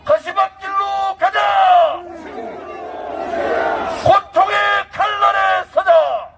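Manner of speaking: high, slow shouted battle cry; roaring rallying speech. Acted. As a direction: roar out the final charge. Closing rally exhortation.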